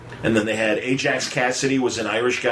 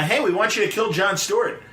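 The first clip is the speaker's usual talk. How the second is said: a hint of a higher, pushy boss; mock executive; 10 semitones higher than usual. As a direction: lightly play the studio boss. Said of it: "the powers that be at D C going".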